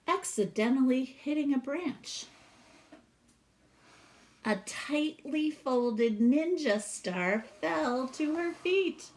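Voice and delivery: lively read-aloud storytelling voice; storybook reading. Acted. Teacher reading a picture book to her class, expressive read-aloud.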